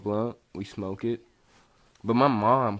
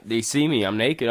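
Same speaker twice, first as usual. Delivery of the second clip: ordinary talk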